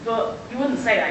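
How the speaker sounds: ordinary talk, nothing marked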